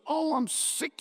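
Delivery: understated angry outburst; narrated shout. A narrator's reading. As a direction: complain sharply, stopping short of shouting. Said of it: "he shouted".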